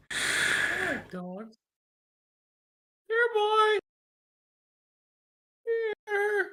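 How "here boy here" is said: high sing-song dog call. Pretend. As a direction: call the dog over, pitched high and drawn out. Calls out to a dog.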